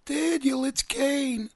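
very high falsetto damsel impression; mocking bad acting. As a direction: overact a helpless wail in a squeaky-high mock voice. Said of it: "Bree's acting was terrible in that segment".

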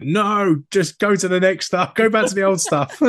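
high, pleading mock heckle; crowd protest. Whole crowd at a gig wanting old songs, high mock protest. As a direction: plead with the band as a fed-up crowd.

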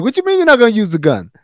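high-pitched, fast, incredulous retort; mock disbelief. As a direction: imitate someone whose mind is blown. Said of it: "that blew Kenny's mind".

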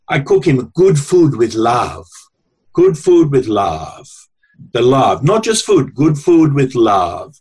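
slow, deliberate mock Italian old woman accent; slow Italian grandmother. The Italian wife explaining her recipe for love, slow light Italian accent. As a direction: spell out each word like a proud Italian nonna. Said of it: "wide Italian woman in their seventies".

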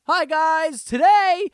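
high, slow, fake upbeat intro; mock a peppy YouTuber greeting; cheesy YouTuber voice. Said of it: "so fucking YouTubery".

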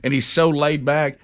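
ordinary delivery

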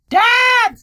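high, loud bleating shout of Dad; screamed high Dad, Dad, Dad; goat screaming Dad. Retold or imitated. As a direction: yell Dad like a goat that sounds like a boy. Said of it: "this annoying boy going".

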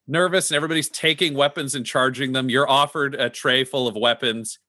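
ordinary talk, nothing marked

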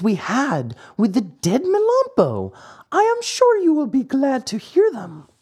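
high, sly weasel voice; wheedling weasel making a deal, pitched high; high, scheming weasel. Acted. Weasel figure speaks to dog.

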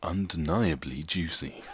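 slow, dramatic reading voice; melodramatic. Pretend. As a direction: read it like a grand announcement. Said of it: "do it in your most dramatic voice".

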